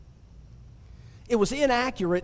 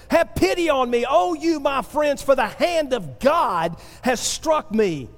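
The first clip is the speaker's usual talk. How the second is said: higher, impassioned scripture plea; heartfelt rant; 4 semitones higher than usual. Acted. Job rants to his friends from the heart, impassioned plea. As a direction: plead it from the heart, pitched high then descending. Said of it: "It's a rant. He is speaking from the heart".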